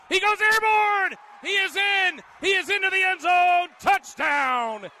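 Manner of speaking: shouted, high excited play-by-play; touchdown call. Real, not acted. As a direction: yell the score as it happens. Live touchdown call on radio.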